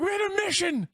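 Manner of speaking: very high panicked shout. Acted. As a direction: yell it desperately. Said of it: "He starts shouting".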